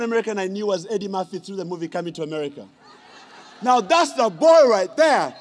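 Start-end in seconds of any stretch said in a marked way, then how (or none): 3.6-5.3 loud, slow, laughing; excited. Real, not acted. About Eddie Murphy, a loud delighted laughing cry. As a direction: relish the name, beaming.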